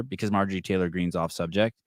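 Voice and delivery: ordinary talk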